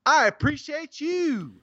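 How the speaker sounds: showy sign-off to a reviewer; catchphrase flourish. Real, not acted. Sing-out catchphrase thanking each reviewer.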